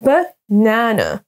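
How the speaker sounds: "Banana" as buh... NA-na, schwa first; slow model pronunciation. Shown as a demonstration. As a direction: repeat "Banana" slowly, long middle syllable. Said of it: "Donc, on prononce".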